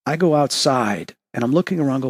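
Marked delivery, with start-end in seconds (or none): none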